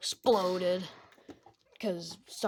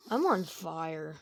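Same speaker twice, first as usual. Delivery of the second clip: ordinary talk, nothing marked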